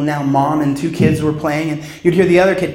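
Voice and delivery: ordinary talk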